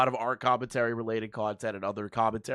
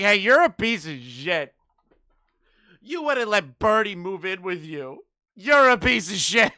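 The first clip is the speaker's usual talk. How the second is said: mocking insult; 5 semitones higher, 6 dB louder than usual. Mocking a harassing message.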